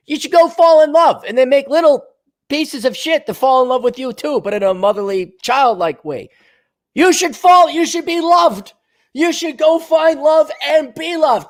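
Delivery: high, sing-song preachy voice; mock sappy advice. Mocking advice to women in a high gushing voice. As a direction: gush it like a syrupy life coach.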